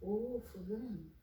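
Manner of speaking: put-on soft, low, measured instruction to a child. Shown as a demonstration. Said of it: "tenho que mudar meu tom de voz".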